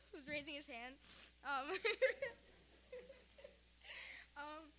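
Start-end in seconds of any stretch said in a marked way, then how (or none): 1.8-2.3 high giggle. Real, not acted. Granddaughter spotting grandpa's raised hand, giggling. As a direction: let out a high laugh.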